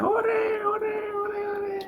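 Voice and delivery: put-on high, fading child's cheer. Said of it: "Anaknya bersuka cita".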